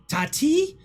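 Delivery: put-on high, fast shout at the child; scolding shout. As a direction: scold her loudly for the dirty toad. GM voices old witch scolding.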